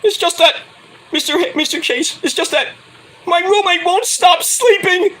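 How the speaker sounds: high put-on voice of a student caught dozing; whiny student excuse. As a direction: squeak out a lame excuse to the teacher. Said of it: "And he's like".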